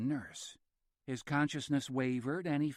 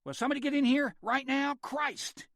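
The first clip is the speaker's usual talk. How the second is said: frightened shout, much higher and louder; shrill yell; 13 semitones higher, 5 dB louder than usual. A narrator's reading. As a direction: yell for help, shrill with fear. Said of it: "yelling as he went, his voice suddenly shrill with fear".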